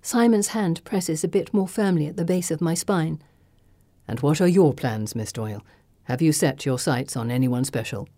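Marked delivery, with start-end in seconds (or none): 4.1-8.1 a lightly lower, intimate flirt; low flirtatious. Acted.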